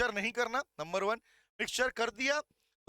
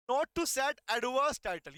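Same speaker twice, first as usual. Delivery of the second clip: slow, word-by-word reading of the heading; slow dictation; much slower than usual. Shown as a demonstration. Teacher showing students how to read the heading. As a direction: spell it out slowly so students can write. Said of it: "इनको ऐसे पढ़ना है".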